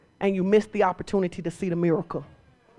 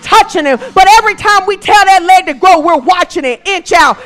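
much louder and higher, shouting the repeats; preaching at full force; 8 semitones higher, 12 dB louder, slower than usual. Real, not acted. Preacher reliving the healing to the church, much louder and higher. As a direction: drive the crowd to a peak.

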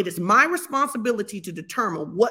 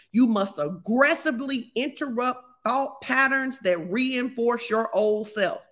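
slow emphasis; slower than usual. Acted. Preaching emphasis.

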